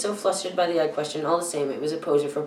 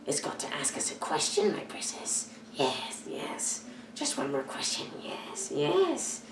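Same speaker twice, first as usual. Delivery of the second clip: Gollum creature voice; 8 dB quieter, slower than usual. A narrator's reading. Gollum presses Bilbo for a question, whispering. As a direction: wheedle like a sly creature.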